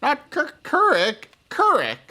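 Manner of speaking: put-on high, emphatic kid correcting his name; child insisting.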